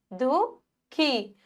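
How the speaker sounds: दुखी slowed with the ख aspirated; aspirated दुखी demo; breathy kh stretched. Shown as a demonstration. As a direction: lean on the ख breath in दुखी, slowly. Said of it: "हा की ध्वनि".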